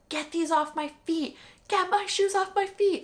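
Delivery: lightly high, pleading voice; mock pained plea. Exhausted runner at the finish, lightly high plea. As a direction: beg to get the shoes off. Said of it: "I was like".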